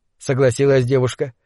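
usual speaking voice

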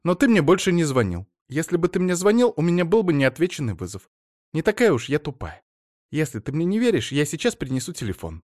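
wife fussing over her husband, in a high chatter. Acted. Wife character voice.

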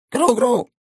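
tiny squeaky creature cry; high squeaky call of a little wood sprite; creature squeak. A narrator's reading. Tiny tree creature calls out to the hero, high and squeaky. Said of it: "выкрикнуло существо".

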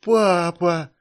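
put-on loud, high childlike cry of joy. A narrator's reading. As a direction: call out to father in delight. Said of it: "ещё больше обрадовался Илья".

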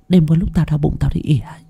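fast hushed whisper. Acted. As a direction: whisper it like a secret. Servant confiding a secret.

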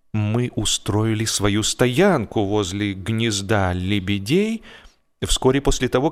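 plain reading voice

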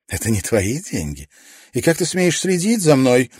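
indignant. A narrator's reading. Caroline outraged.

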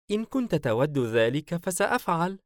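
high-pitched, sweetly smiling offer. A narrator's reading. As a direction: offer obligingly with a smile. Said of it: "سعلوة باسمة". (نبرة عالية باسمة)

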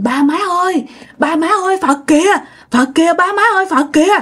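high excited call to parents. Retold or imitated. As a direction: cry out, pointing at the sky. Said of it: "cô Năm mới la lên". (gọi to, giọng cao và hào hứng)

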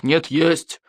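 stubborn grown-up contradiction; king insisting back. A narrator's reading. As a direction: answer back firmly without raising pitch. King contradicts boy in quarrel.

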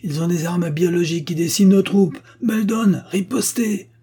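high, loud furious roar; roar of anger. A narrator's reading. Carruthers roars angrily at his men to return fire, high and loud. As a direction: roar at your troops to strike back. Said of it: "Carruthers rugit de colère".

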